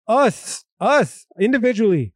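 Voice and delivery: "us" repeated, each one stretched and set apart by a pause; stress on us. Real, not acted. Speaker stressing whose happiness matters, emphatic "us". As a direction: lean hard and slowly on each "us". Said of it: "I emphasize the us element".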